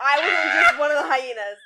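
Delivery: loud, insistent protest; emphatic shout. Real, not acted. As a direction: shout it out indignantly. Insisting on her role.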